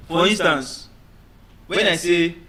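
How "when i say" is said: ordinary talk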